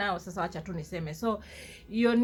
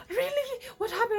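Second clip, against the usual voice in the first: surprised; 13 semitones higher than usual. Retold or imitated. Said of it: "They're like".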